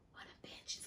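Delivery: hushed unvoiced aside; whisper. Real, not acted. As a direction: whisper it like a secret insult. Whispers insult in story.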